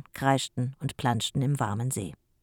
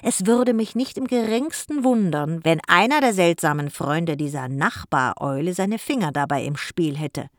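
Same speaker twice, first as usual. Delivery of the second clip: deliberately louder; 2 semitones higher, 5 dB louder than usual. Acted. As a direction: raise the voice so the hedge hears it. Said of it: "sprach deutlich lauter weiter".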